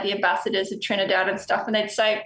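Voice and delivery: normal talking voice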